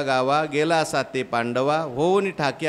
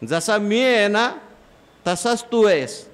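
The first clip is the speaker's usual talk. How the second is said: higher, louder lion voice insisting; commanding lion; 6 semitones higher, 4 dB louder than usual. Acted. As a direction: drive it home like the big lion. Lion convincing the cub. (ठाम, मोठ्या आवाजात सिंह)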